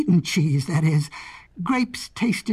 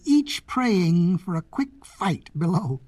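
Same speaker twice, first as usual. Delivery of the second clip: usual speaking voice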